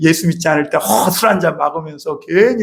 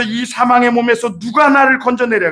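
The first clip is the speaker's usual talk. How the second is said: higher, pleading cry with 누가 leaning hard; cry of despair; 6 semitones higher than usual. Shown as a demonstration. Paul cries to God for rescue, high pleading cry. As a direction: lift the voice and cry it out. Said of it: "그랬죠".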